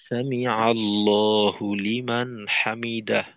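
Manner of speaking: slow chant, হামিদাহ closed with an audible breath of h; stretched recitation, the h sounded at the end of হামিদাহ; clear final h. Shown as a demonstration. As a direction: recite slowly and end হামিদাহ on a distinct "dah". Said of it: "তো হাটাকে স্পষ্ট রাখতে হবে".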